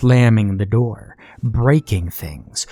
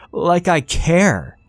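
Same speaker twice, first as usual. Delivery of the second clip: higher, slow defiant yell; defiant shout; 8 semitones higher, slower than usual. Acted. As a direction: fling it at mother. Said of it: "Danny shouted".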